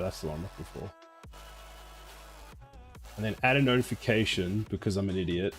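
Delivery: usual speaking voice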